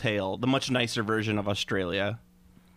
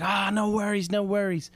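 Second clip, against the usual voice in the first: a light, higher Aussie "no worries, mate"; mock Australian buyer; 8 semitones higher than usual. Buyer replying to the seller, a mock Australian accent. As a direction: play the breezy Aussie bidder. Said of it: "And he's like".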